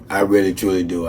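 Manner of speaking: soft, low, heartfelt; mean every word of it quietly; sincere thanks. Real, not acted.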